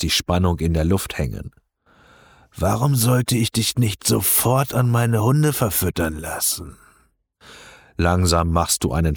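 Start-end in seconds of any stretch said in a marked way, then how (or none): 2.6-6.8 threatening reptilian crime lord; cold, amused menace; deep guttural voice. Acted. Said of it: "mit tiefer, kehliger Stimme". (tiefe, kehlige Drohung)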